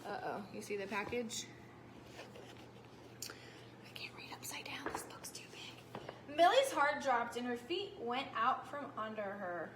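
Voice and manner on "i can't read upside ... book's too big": soft unvoiced aside; whisper. Real, not acted. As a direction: whisper the excuse to yourself. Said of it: "whispered aside while reading".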